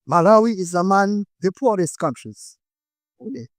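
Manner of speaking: normal talking voice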